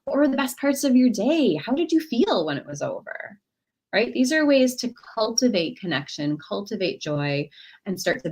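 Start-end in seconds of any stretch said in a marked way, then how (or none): 0.0-3.4 high, eager, interested; warmly curious, asking for more; joy cultivator. Pretend. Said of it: "she could be a joy cultivator, showing authentic interest".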